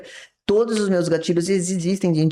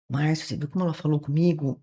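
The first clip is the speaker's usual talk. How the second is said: softer, wounded complaint; mock hurt victim voice, a touch softer; 5 dB quieter than usual. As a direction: tell on her in a hurt tone. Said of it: "fui pra vítima".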